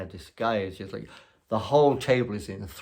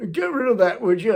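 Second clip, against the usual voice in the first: high, loud, imperious order; a pompous diner barking at the waiter; rude guest; 13 semitones higher, 9 dB louder than usual. Retold or imitated. Loud guest to the narrator serving, high and haughty.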